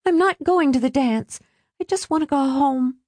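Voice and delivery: put-on high-pitched unhappy girl; upset teenage daughter. A narrator's reading. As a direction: plead to be let off, pitched high. Upset daughter refuses the dance.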